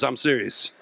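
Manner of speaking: normal talking voice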